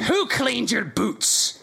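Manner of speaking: menacing question. Acted. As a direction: drag each word out threatening. Villain brother interrogates sister.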